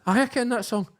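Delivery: high-pitched mock grumpy woman; frowning grudging reply. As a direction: answer through a frown, imitating her. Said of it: "like, still while frowning".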